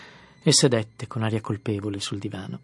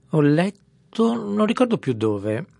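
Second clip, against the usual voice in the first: slow, higher and louder hearty bluster; jovial Tom; 9 semitones higher, 6 dB louder, slower than usual. A narrator's reading. Tom at lunch to his guests, hearty and loud. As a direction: play the genial host holding forth. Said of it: "disse Tom con aria gioviale".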